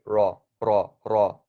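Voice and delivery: raw said as a heavy Arabic R, not English; deep heavy R in raw. Shown as a demonstration. Teacher showing ra like raw fish. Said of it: "Like raw fish".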